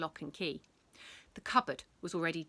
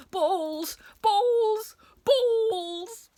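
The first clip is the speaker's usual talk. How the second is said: frantic shouts; 14 semitones higher, 10 dB louder, much slower than usual. Acted. Teacher grabbing at balls.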